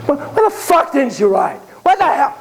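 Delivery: angry, high-pitched outburst; going off. Retold or imitated. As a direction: suddenly blow up at the boy. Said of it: "He starts going off".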